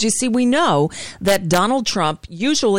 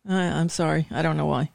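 barely audible; 5 semitones lower, 6 dB quieter, faster than usual. Real, not acted. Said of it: "You sound very faint".

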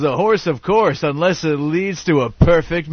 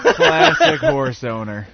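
higher, slow put-on voice; mock horse owner; 10 semitones higher, much slower than usual. Co-host mocks horse owner, high and slow. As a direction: drag it out like a fussy owner.

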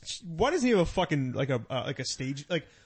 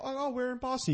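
high, mock-puzzled question, much higher; mock curious stranger; 10 semitones higher, 5 dB quieter than usual. Traveler asking where in Boston, high mock question. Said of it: "And they go".

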